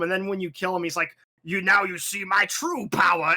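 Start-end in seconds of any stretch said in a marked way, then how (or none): 1.4-3.4 louder, grandiose villain voice; hammed-up boss villain. Player voices the boss revealing his true dragon power, hammed-up villain. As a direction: proclaim it like a mock final boss.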